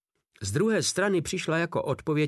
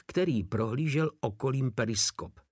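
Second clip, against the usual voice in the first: plain reading voice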